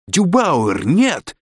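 high-pitched cry of warning; urgent "no", pitch leaping up; alarmed cry. A narrator's reading. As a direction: call out in alarm, short of screaming. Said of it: "закричала Корделия".

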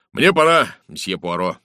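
inspector's loud, abrupt leave-taking; brisk announcement. Acted. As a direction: call out that it's time to go. Inspector calls out his leaving.

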